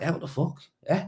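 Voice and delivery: high, quiet retold disbelief; mock offended disbelief. Boss recalling his shock at the worker, high disbelief. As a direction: recall being miffed, pitched high. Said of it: "I was like".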